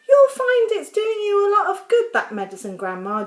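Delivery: loud, high call at first, then easing; shouting. A narrator's reading. George telling Grandma the medicine works, shouting. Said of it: "George shouted".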